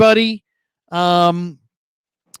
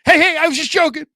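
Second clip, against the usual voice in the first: lightly acted high, quick plea; mock-panicked protest to security; mock backpedaling; 9 semitones higher, faster than usual. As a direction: squeak out a hurried excuse. Backpedals as imagined reveler ejected.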